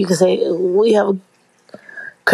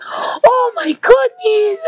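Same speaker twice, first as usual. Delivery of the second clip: shocked exclamation; 14 semitones higher, much slower than usual. Retold or imitated. Retelling the nurse's shock at no underwear, high mock alarm. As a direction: play the nurse's shock big.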